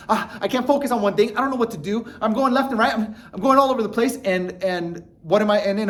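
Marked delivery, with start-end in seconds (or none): 0.1-4.2 higher, fast scattered panic; a frazzled, higher rush; flustered panic. Retold or imitated. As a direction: sound scattered and overwhelmed. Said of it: "If I'm overwhelmed".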